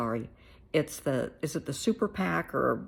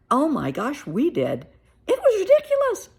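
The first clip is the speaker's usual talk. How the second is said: loud, much higher, squealing disbelief; mock shrieking friend; 10 semitones higher, 9 dB louder than usual. A UK friend shrieks about the ridiculous debate, loud and high. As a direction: shriek it like an appalled gossip.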